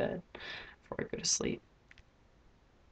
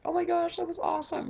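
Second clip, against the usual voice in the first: high, squealing delight over the episode; gushing fan; 12 semitones higher than usual. Retold or imitated. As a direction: gush like a thrilled fan. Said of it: "usually I'm like".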